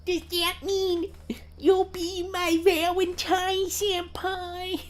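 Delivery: high, slow cutesy plea; coy anime girl voice. Pretend. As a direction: bat your eyelashes at senpai.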